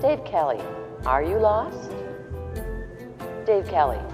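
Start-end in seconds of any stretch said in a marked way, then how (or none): none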